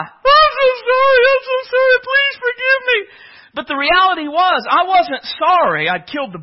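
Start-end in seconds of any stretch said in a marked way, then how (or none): 0.0-3.0 put-on high, crying child's plea; boy sobbing his apology to his mom, high and tearful. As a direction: beg like a sobbing kid caught red-handed. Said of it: "I began to cry".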